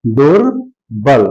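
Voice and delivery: दुर्बल drawn out very slowly; slow sounded-out word. Shown as a demonstration. Teacher answers his own question, sounding out दुर्बल slowly. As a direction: give each sound of दुर्बल its full time. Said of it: "क्या बन गया?".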